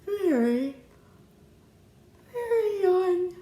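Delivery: very yawn pulled out high and slow; sleepy yawning. Acted. Mister Snore yawning at the desk. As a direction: yawn through the words. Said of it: "I am".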